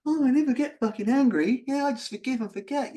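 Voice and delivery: high, loud mock of people who never get angry; mocking people who deny their anger, loud and high; mocking loud denial. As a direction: parody their cheerful denial. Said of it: "all these people are going".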